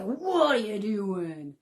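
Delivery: a hint of a stern father telling kids off; mock dad scolding the kids, slow and firm; gruff dad. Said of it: "gruff voice".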